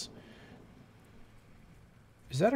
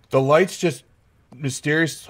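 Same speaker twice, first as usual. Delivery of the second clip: ordinary delivery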